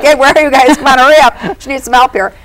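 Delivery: half-laughing call to the spirits to hurry; impatient mock call into the beyond. Calling out to absent spirits.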